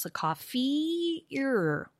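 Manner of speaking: "coffee-er" drawn out slowly, the vowels linked. Shown as a demonstration. Teacher slowly demoing linked vowels to a colleague. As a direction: stretch "coffee-er" so the join is heard. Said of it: "you link the two, the two vowels".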